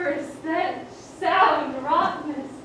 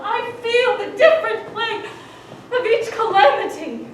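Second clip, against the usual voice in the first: louder, higher lament; raw lament; 4 semitones higher, 6 dB louder than usual. Acted. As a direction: wail out the pain. Constance in grief.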